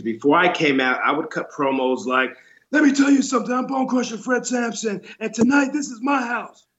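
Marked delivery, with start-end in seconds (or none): 2.7-6.7 macho wrestler promo voice. Retold or imitated. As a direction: ham up a wrestling heel promo, meant to sound masculine. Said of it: "I would make my voice sound more masculine".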